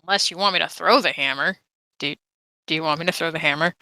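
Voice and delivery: usual speaking voice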